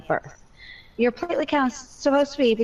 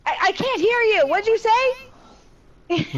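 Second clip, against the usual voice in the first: mock deaf act; 12 semitones higher, slower than usual. Mom teases a nosy stranger by pretending she can't hear, high and slow. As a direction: play deaf to mess with them. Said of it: "And I go".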